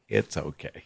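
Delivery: wife gently calming him, fading; soothing, fading. Retold or imitated. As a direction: let it fade to almost nothing. Wife reassuring him, repeated.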